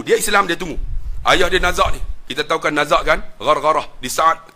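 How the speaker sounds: ordinary talk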